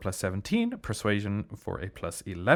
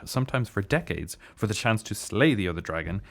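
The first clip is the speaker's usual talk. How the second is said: normal talking voice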